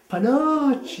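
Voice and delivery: high, loud burst of the word panoch; horrified realization. Retold or imitated. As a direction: blurt panoch in shock. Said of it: "thinking in his head".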